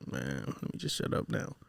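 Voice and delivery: whispered under the breath, resigned; inner whisper. Retold or imitated. Son retelling his own thoughts under mom's stare, whispered. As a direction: breathe it like a thought you daren't say aloud. Inner voice when mom gives look.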